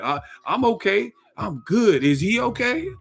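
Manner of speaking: high, smug mock fighter; mock petty boxer. Podcaster mimicking Charlo at an interview, high and mocking. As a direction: taunt the beaten man with a singsong. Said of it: "and be like".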